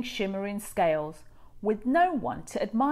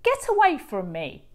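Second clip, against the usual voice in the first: louder, sharp dismissal; angry cry; 7 semitones higher, 8 dB louder than usual. A narrator's reading. Rainbow fish drives the little fish away, angry. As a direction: shoo him off in anger. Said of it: "cried the rainbow fish".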